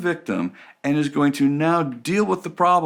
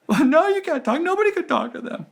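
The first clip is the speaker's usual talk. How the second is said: much higher, petulant whine; whiny complainer. Retold or imitated. Complainer refusing to talk to the other person. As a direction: whine the excuse. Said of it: "if you come to me and go".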